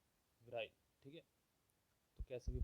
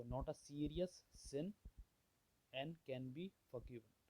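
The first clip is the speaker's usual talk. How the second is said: very slow, deliberate explaining; slow classroom gloss; slow explanation; much slower than usual. Shown as a demonstration. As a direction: spell the meaning out slowly for students. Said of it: "teacher explaining meaning slowly".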